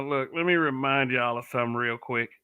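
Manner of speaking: usual speaking voice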